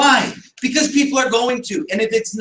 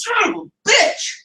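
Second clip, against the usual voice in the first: drawn-out, higher exasperated "bitch" with a rising end; frustrated curse; 10 semitones higher, slower than usual. Retold or imitated. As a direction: let the private annoyance slip out. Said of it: "a part of me in that moment that goes".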